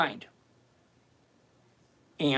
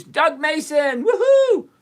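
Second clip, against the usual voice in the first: high, loud, drawn-out cheer; big hollered shout-out rising into a whoop; excited whoop; 10 semitones higher, 7 dB louder, slower than usual. Real, not acted. Hollered greeting to a chat member.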